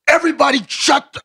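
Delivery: commanding quarterback. Retold or imitated. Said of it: "he goes".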